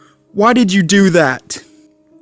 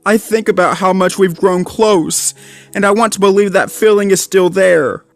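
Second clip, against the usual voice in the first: holding back sobs, head lowered; crying mutter; 9 semitones higher than usual. A narrator's reading.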